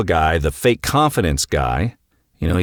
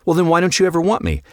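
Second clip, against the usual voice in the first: higher, fast pleading demand; pressing husband; 10 semitones higher, much faster than usual. Retold or imitated. Husband demanding why his wife won't want him, high and fast. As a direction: push her for an answer, quickly.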